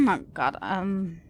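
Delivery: sore-throated, quiet hoarse voice; strained voice from a sore throat. Real, not acted. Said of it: "My throat is hurting".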